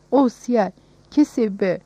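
delighted cry. A narrator's reading. Child opening her present, mildly crying out in delight. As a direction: exclaim with a touch of rapture. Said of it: "diye bağırdı".

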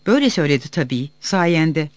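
usual speaking voice

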